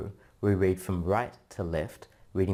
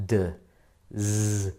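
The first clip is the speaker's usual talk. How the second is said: normal talking voice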